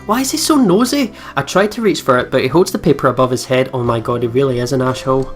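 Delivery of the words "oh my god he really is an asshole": ordinary delivery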